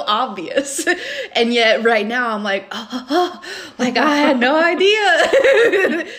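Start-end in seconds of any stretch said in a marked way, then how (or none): 2.7-6.1 slow, high, laughing fake realization; mock surprise. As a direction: play dumb amazement.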